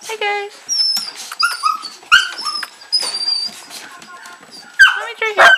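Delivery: high, loud cooing to puppies. Real, not acted. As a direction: reassure the whining pups, high. Soothing crying puppies.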